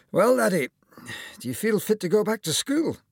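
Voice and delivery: lightly voiced cheery doctor; kindly old surgeon. A narrator's reading. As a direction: play the jolly surgeon greeting his patient. Surgeon at final appointment.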